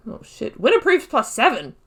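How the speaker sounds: normal talking voice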